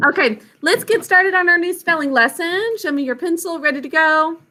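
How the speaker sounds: teacher opening a spelling lesson, upbeat; bright teacher voice to a class. Acted. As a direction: address the kids like a teacher starting a lesson.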